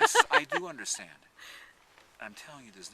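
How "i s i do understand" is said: loud insistence, much higher. Retold or imitated. Farmer insisting to the boy, loud and high. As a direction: insist loudly over the kid. Man insisting back at kid.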